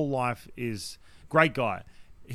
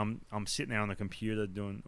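normal talking voice

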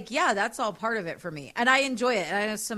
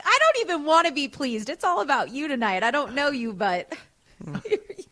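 higher and louder, all eager to please; eager hookup voice; 6 semitones higher, 7 dB louder than usual. Retold or imitated. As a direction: play the eager random hookup. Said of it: "find a random and be like".

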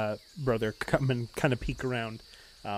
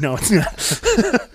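slow, drawn-out mock denial, a bit higher and louder; mock retort; 8 semitones higher, 6 dB louder, much slower than usual. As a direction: drag out the denial to tease a friend. Mocking retort at the table.

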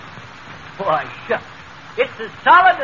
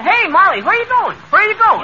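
calling out; 7 semitones higher, 5 dB louder than usual. Acted. Calling after Molly as she leaves.

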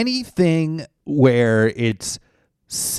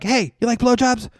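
high mock call; 13 semitones higher than usual. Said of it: "they'd be like".

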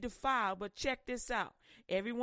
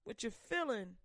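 normal talking voice

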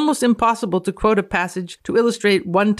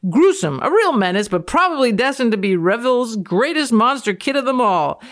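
hammed-up quote reading; 5 semitones higher, 4 dB louder than usual.

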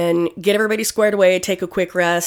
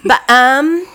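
put-on Valley girl; 7 semitones higher, 6 dB louder than usual. Shown as a demonstration. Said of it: "Valley girl".

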